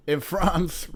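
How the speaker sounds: normal talking voice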